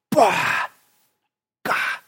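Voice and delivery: short vocal blasts for a deadly blow; imitated impact noises. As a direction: mimic a sudden strike from above.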